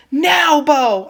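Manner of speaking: high, loud shriek. Acted. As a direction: scream the command in terror. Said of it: "I shrieked".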